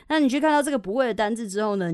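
usual speaking voice

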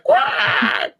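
high squawking pterodactyl screech; pterodactyl sound. Pretend. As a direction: squawk like a prehistoric bird. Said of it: "trying to make a pterodactyl sound".

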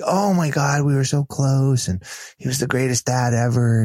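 high-pitched, fond gush, easing down. Retold or imitated. Daughter telling a stranger about her dad, gushing.

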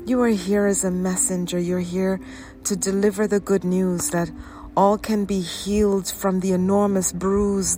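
usual speaking voice

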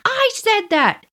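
high-pitched mock call-back; Kathy's defiant reply. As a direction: imitate a cheeky kid owning up. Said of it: "Kathy's like".

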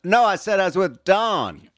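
mock suspect.

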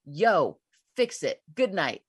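loud, casual command to your dreams; blunt order. Shown as a demonstration. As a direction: toss out punchy orders and sign off.

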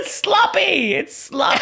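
very high squeal through real laughter; laughing shriek. Real, not acted. Co-host reacting to the comeback twist, squealing with laughter. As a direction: squeal it out while cracking up. Cracks up at co-host calling it gag.

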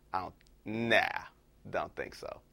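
low, trailing-off brush-off; dismissive rejection. Retold or imitated. Woman turns down the pickup line.

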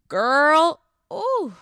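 an excited squeal of disbelief; excited exclamation. Real, not acted. Telling listeners about her twenties, excited and high.

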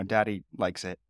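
usual speaking voice

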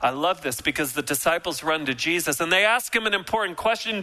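ordinary talk

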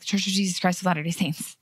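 name spat out in one quick run; rushed, embarrassed. Shown as a demonstration. Host mimicking members embarrassed by the name, rushed. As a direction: hurry through it as if embarrassed. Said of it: "spitting it out like you're embarrassed of it".

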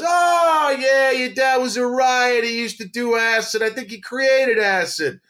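high, strained character voice, held on one note; old dentist impression. Dentist tells son about his dad, high put-on voice. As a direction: play an incredulous dentist, slow and high.

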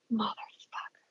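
soft, seething aside; seething under the breath. Retold or imitated. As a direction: curse quietly to yourself. Spots Gary, seething to herself.

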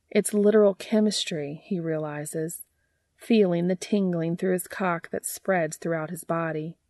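plain reading voice

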